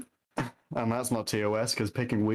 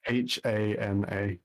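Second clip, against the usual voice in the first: slow spelling; slower than usual. Shown as a demonstration. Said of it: "One more time".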